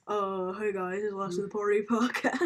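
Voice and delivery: lightly voiced podcast host intro; a hint of a radio host dad; impression of dad podcasting. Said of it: "That's not what I sound like".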